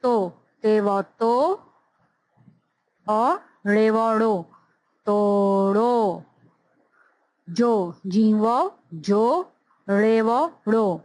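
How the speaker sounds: drawn-out letter-by-letter spelling for beginners; slow phonics spelling. Shown as a demonstration. Teacher spelling words aloud for young students, slow and deliberate. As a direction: sound out each letter slowly, then land the whole word.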